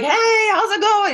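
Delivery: high, slow, laughing hey acting casual; fake casual greeting. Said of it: "I'm just an actor".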